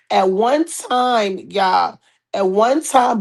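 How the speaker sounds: slow, drawn-out storyteller emphasis. Acted. Host setting up the gossip story, slow and drawn out. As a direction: lean on each word, slowly.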